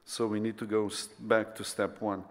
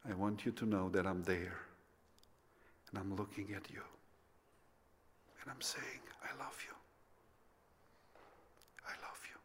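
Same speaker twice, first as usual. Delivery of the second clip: very soft, fading into a whisper; tender reassurance; 3 semitones lower, 12 dB quieter than usual. Retold or imitated. God telling the fallen young believer He loves him, tender reassurance. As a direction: imitate God's gentle voice, almost whispering.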